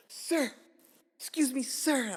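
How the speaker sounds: high-pitched acted plea for attention; urgent calling out. Customer flagging down a waiter for the bathroom, urgent high call. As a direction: call out high to flag someone down.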